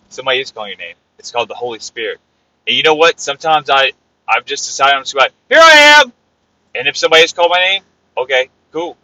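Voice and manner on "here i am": loud, high-pitched answer to a call; ringing cry of readiness. Acted. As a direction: shout it up to God, announcing yourself. Acts out answering God's call.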